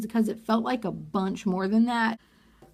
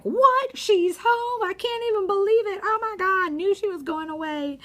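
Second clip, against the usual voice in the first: much higher, shocked gossip voice; mock shock; 10 semitones higher than usual. Imitating the girls shocked that she went home. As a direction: gasp it out like scandalized gossip. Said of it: "the girls are like".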